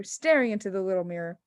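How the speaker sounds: ordinary delivery, nothing marked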